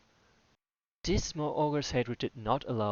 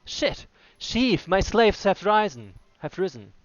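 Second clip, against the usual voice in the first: higher, louder alarm; panicked orc; 8 semitones higher, 10 dB louder than usual. Acted. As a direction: blurt it out in a panic. Panicked orc reports revolt.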